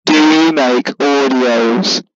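very loud, drawn-out question; loud, slow repeat. Real, not acted.

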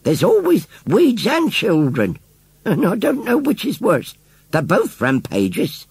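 put-on old lady voice. A narrator's reading. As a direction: play a dry old countrywoman, drawn out. Old lady grumbling about children.

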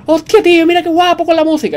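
delighted outburst at the music; excited. Real, not acted. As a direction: gush about how cool it sounds.

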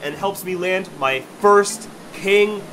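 ordinary talk

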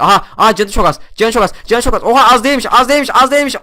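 high, loud excited chatter; yelling at the screen in a frenzy. Real, not acted. Excited shouting over low health.

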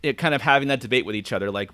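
ordinary delivery

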